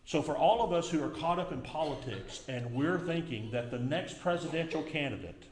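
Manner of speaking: usual speaking voice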